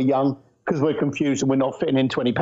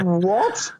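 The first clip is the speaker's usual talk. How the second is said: shocked what; 3 semitones higher than usual. Retold or imitated. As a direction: recoil at the question as if stunned. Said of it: "And I said".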